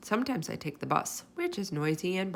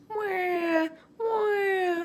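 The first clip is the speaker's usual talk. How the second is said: high, drawn-out mimicked baby cry; imitation crying baby, pitched way up; baby's wail; 13 semitones higher than usual. A narrator's reading. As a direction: wail "wah" like an infant. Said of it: "made a very loud noise".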